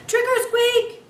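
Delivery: high, loud squeaky shout. Acted. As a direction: burst out from under the cloth. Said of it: "I cried".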